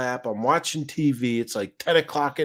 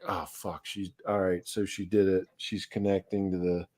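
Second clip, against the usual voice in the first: much softer, under his breath; muttered dread; 4 semitones lower, 8 dB quieter than usual. Retold or imitated. Owner quietly dreading the camera call, muttered. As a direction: mutter it so the dogs won't hear. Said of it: "I'm like".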